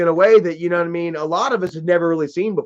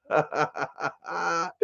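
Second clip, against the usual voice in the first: laughing hard; 2 semitones lower, 12 dB quieter than usual. Real, not acted. Laughing at trolls.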